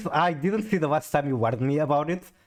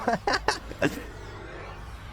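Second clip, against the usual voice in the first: high, breathless soft laughter; real laugh; 14 semitones higher, 6 dB quieter than usual. Real, not acted. Laughing at someone who got the bell, high airy giggle.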